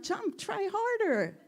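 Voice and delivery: cheering on. Retold or imitated. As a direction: cheer him up the wall. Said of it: "I thought you were saying".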